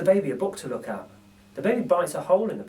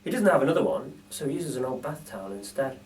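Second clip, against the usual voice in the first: ordinary delivery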